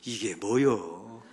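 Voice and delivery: slow, low Chungcheong drawl; short and slow Chungcheong question; Chungcheong dialect. Retold or imitated. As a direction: drag it out like a laid-back Chungcheong local. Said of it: "충청도 사람들은 이걸 단 한마디로 짧게 이렇게 말합니다".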